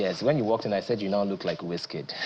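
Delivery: ordinary talk, nothing marked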